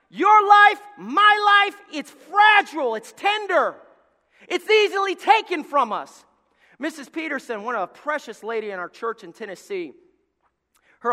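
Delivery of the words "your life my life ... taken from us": high, loud, slow fervent preaching; preaching shout. Real, not acted. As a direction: cry it out over the church. Preacher building to full force.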